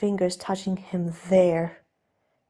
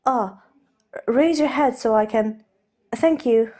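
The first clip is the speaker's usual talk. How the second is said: raised, lighter voice for the nervous aristocrat; Steed, flustered gentleman; 4 semitones higher, 5 dB louder than usual. Acted. Steed offering to undo Ed's cravat, nervously polite. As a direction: play Steed, polite and a little jittery.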